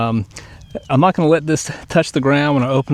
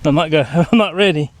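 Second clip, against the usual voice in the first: laughing; 5 semitones higher, 4 dB louder, faster than usual. Real, not acted. Laughing while launching the paraglider.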